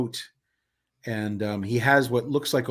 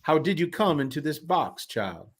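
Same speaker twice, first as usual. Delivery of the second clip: raised, formal voice falling on the last word; theatrical carnival man; 4 semitones higher than usual. Acted. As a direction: question her with stately curiosity. Voices a game character.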